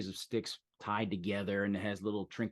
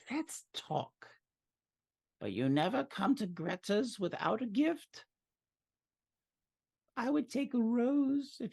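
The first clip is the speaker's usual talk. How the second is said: very high, sing-song crone demanding a gift; Greta's witch voice; 3 dB louder, slower than usual. Acted. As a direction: sweetly set the price of entry. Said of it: "She says".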